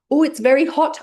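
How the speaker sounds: high, loud startled cry at hot food; mock burnt mouth. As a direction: yelp as if the food burns. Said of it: "the hot food laughter".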